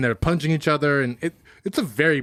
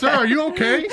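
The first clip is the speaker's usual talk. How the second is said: mock concerned customer; 10 semitones higher than usual. Acting coffee shop customer.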